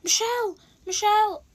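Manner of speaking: high excited call across the playground. Retold or imitated. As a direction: shout her name up high, bursting with news. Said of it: "And she's like".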